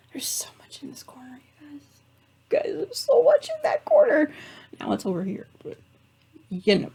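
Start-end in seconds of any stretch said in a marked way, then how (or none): 0.1-1.8 whispered aside about the corner; whispering. Real, not acted. Complaining about the cluttered corner. 2.5-4.3 high, loud exasperated wail; exasperated squeal. Real, not acted. Repeats the complaint in a louder voice.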